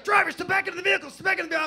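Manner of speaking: loud, high, fast police order; hammed-up cop yelling at a drunk driver; cop shout. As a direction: bark it like a cop on a bullhorn. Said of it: "he's like".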